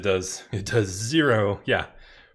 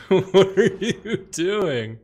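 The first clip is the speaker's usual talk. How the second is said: laughing disbelief; 9 semitones higher, 6 dB louder, much slower than usual. Real, not acted. Laughing at opponent's play.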